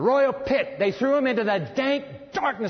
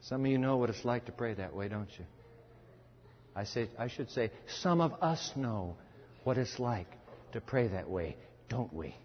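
low, soft, intimate preaching; subdued, tender admission; hushed confiding; 10 semitones lower, 9 dB quieter than usual. Real, not acted. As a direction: drop low and confide in the congregation. Preacher tenderly identifies with weeping sinners.

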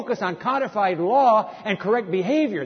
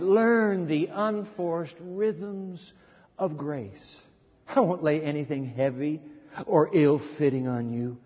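soft, lower, unhurried scripture read; quiet, lower devotional reading; tender scripture reading; 4 semitones lower, 3 dB quieter, slower than usual. Acted. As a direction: read it like a gentle invitation. Reads The Message aloud.

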